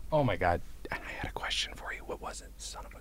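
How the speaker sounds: hushed unvoiced whisper. Real, not acted. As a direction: whisper it to yourself. Whispering after leaving room.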